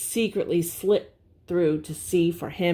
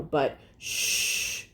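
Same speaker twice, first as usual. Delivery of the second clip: unvoiced hush; a sudden secretive shh; 4 semitones lower, 5 dB quieter than usual. A narrator's reading. Warning about spies.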